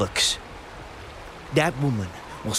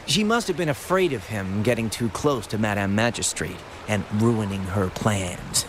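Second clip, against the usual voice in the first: usual speaking voice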